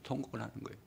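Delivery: hushed echo after the cry; soft repeat. Shown as a demonstration.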